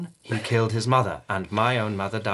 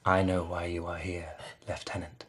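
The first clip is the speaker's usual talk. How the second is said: hushed, softer, secretive whisper; softer ominous whisper; 5 semitones lower, 9 dB quieter than usual. Acted. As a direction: breathe it close and low. Lord whispers over fainted man.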